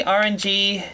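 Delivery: usual speaking voice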